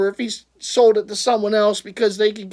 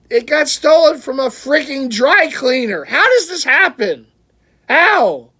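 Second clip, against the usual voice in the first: higher and louder, exasperated; upset disbelief; 6 semitones higher, 5 dB louder than usual. Real, not acted. Collector to viewers about the stolen jersey, higher and louder outrage. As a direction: let the outrage climb. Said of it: "now I'm getting upset".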